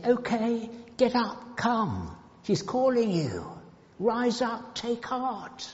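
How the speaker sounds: high, coaxing crowd voice; acted encouragement. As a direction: urge someone up in a lifted voice. Acting out the crowd's changed encouragement.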